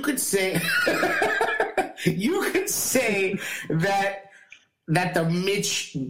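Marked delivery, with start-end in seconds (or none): 0.9-4.2 words broken by a laugh. Real, not acted. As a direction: laugh through it slowly. Laughs mid-sentence at the joke.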